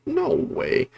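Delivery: surprised. Retold or imitated. Viewer reacting in disbelief.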